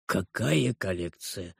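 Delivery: low, slow, stern; frowning question. A narrator's reading. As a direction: ask it with a frown. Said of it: "нахмурился Гарольд Сингер". (хмурый вопрос, низко)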